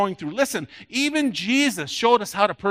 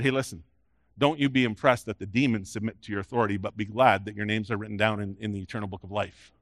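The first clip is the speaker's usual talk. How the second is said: calm caution; 9 semitones lower, 5 dB quieter than usual. Retold or imitated. Jesus calming the excited disciples.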